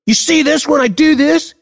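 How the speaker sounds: mock little kid voice; imitating his son. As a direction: play the five-year-old laying down the law. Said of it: "He goes".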